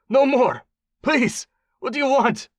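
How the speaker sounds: high, loud, panicked begging; desperate pleading. A narrator's reading. Tortured warlord begs his captor, pleading desperately. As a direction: beg for it to stop. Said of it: "Mombasi pleaded with wild eyes".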